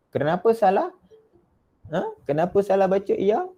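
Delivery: normal talking voice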